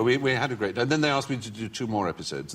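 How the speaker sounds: normal talking voice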